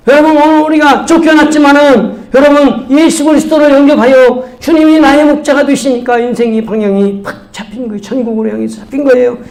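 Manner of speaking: high, projected preaching; forceful pulpit proclamation. Real, not acted. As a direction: exhort the congregation with rising conviction.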